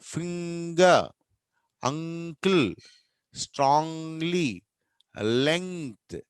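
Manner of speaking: slow word list, holding ng in ఫింగర్ and అంకుల్; exaggerated teacher's ng in ఫింగర్, word by word; model middle ng. Shown as a demonstration. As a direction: stretch the nasal inside స్ట్రాంగ్లీ and లెంగ్త్. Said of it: "middle position వస్తే".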